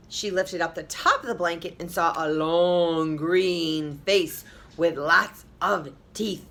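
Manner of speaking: plain reading voice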